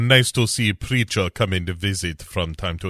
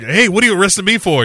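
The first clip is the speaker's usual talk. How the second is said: indignant protest; 10 semitones higher, 4 dB louder, much faster than usual. Retold or imitated. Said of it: "when he's like".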